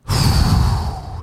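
long breathy exhale of relief; sigh of relief. Shown as a demonstration. After the director yells cut, a big sigh of relief. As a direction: let all the tension out in one big breath. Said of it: "this sigh of relief".

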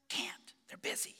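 put-on small boy whispering into the phone; whispering child. Little Jimmy answering the pastor's call, whispering. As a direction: breathe it like a hiding four-year-old. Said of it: "a voice answered with a whisper".

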